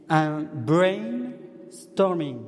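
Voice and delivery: "brainstorming" drawn out slowly in an English accent, "brain" and "storming" stretched. Shown as a demonstration. Joking to the parish group about his English accent. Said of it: "Excusez pour mon accent anglais".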